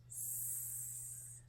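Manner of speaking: "S" hissed softly through teeth; snake hiss. Shown as a demonstration. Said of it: "Like a snake".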